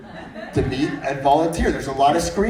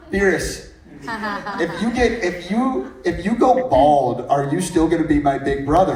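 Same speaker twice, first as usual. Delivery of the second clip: put-on higher kid voice broken by laughter; imitating a six-year-old, laughing; 5 semitones higher, 4 dB louder than usual. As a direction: play the little boy asking, giggling through it. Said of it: "he's, he's like".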